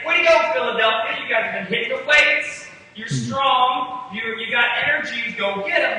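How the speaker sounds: mock pep talk. Mock pep talk Jesus doesn't give.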